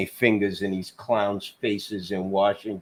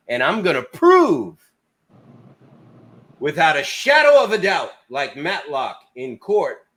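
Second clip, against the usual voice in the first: prove it like a lawyer in court; mock Matlock vow; 9 semitones higher, 5 dB louder than usual. Real, not acted.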